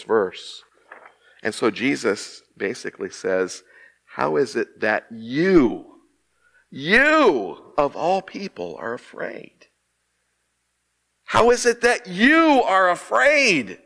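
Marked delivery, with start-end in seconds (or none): 4.0-9.7 slow, with "you" stretched long and repeated; emphasis on YOU. Acted. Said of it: "when they wanted to emphasize a word". 11.2-13.8 high, slow, incredulous stress on "you"; emphatic YOU. Acted. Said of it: "this is the word I wanna emphasize".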